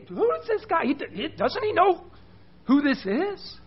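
high, incredulous questioning; indignant Pharisee. Acted. Preacher voices the Pharisee doubting Jesus, indignant and high. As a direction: sputter in high-pitched disbelief as the Pharisee. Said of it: "the Pharisee, he's like".

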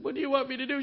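high, loud exasperated husband, retold; hammed-up helpless plea; flustered. Flustered husband facing crying wife.

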